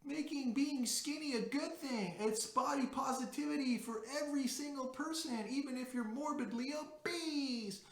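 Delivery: high, whiny mock complainer voice. Vlogger mocking tweet complainers in a high whiny voice. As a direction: mimic the people you mock, in a high whine. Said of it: "saying things like".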